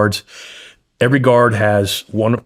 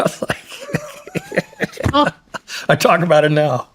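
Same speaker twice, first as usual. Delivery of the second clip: laughing through the words; can't help cracking up; much slower than usual. Real, not acted. Laughing at his buddy nearly getting caught, laughing.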